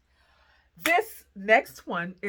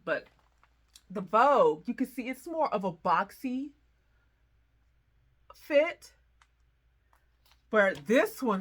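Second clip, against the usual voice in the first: ordinary talk, nothing marked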